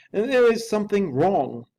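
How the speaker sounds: a frightened servant's high, loud plea; high, loud, fearful manservant; anxious butler. A narrator's reading. Poole's anxious voice in story.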